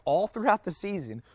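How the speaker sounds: a touch of the NBA secretary. Retold or imitated. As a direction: lightly play the secretary telling it. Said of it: "And she's like".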